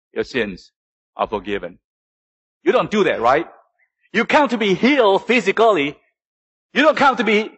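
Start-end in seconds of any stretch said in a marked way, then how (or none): 0.1-1.8 solemn pronouncement. Acted. Jesus pronouncement repeated softly.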